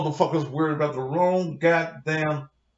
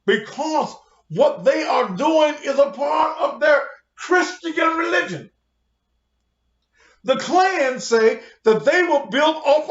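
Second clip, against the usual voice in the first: higher, louder, slow shouted preaching; 8 semitones higher, 3 dB louder than usual. Real, not acted. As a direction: hammer it home like a sermon. Preaching rant at full force.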